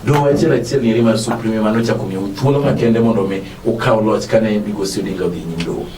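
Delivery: usual speaking voice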